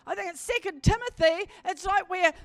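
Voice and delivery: loud, high, fervent preacher's cry; full-force preaching. Acted. Preacher calling the congregation to cry out, loud and fervent. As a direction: preach it out, rousing the whole congregation.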